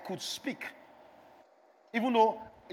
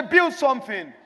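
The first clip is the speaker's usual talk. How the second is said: call and response; 5 semitones higher, 7 dB louder, slower than usual. Shown as a demonstration. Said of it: "Somebody say".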